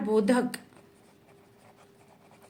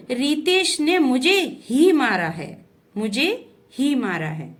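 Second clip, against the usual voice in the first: ही punched louder in "Ritesh ने मुझे ही मारा है"; stress on ही; 2 semitones higher, 4 dB louder than usual. Shown as a demonstration. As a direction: lean hard on ही, louder than the rest. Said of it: "जिस शब्द पर जोर दिया जाता है". ("ही" पर ज़ोर देकर)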